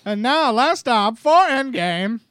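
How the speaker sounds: slow, booming trailer announcer; Marvel announcer voice. Acted. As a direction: announce it like an old newsreel. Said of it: "that was my Marvel announcery voice".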